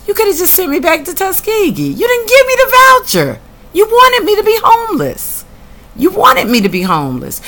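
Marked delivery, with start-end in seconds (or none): 0.1-3.4 outraged accusation. Real, not acted. Confronting housing authority over termination. 3.7-5.4 pitched high and loud, outraged; outraged high cry. Real, not acted. First outraged take.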